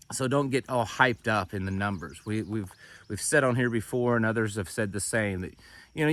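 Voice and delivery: ordinary talk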